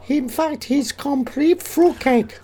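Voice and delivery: high mock Japanese woman; put-on Japanese falsetto, slowing at the end; female Japanese psychiatrist. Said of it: "Female Japanese psychiatrist".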